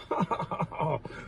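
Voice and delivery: breathy burst of laughter; amused laugh. Real, not acted. Recalling hosts who make him laugh, amused laughing. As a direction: laugh softly at a funny memory. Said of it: "Kevin makes me laugh".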